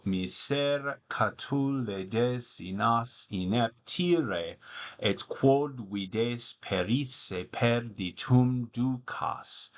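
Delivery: slow, measured Latin verse reading; poetry recitation. Acted. Tutor reads Catullus aloud to students, slow recital.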